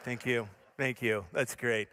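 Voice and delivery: low, subdued acknowledgement; deadpan sheepish thanks. Retold or imitated. Embarrassed storyteller to firefighter, low sheepish thanks. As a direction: take the jab in a low voice. Retold sheepish reply to fireman's jab.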